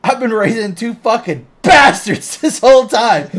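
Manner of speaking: high, loud, laughing rant as Frank; raging Frank. Retold or imitated. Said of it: "he's just all like".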